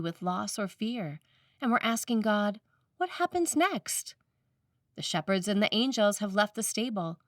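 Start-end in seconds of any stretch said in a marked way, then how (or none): 3.0-4.1 high, slow, wondering ask; searching question. Acted. Preacher voicing the seekers' question, high and slow. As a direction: lift it up like a seeking soul.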